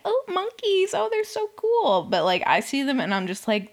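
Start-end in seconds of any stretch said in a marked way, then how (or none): 0.1-2.0 very high-pitched imitation of delighted tourists; mock gushing. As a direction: squeal it like an overexcited tourist, mockingly. Mimics tourists who love the monkeys.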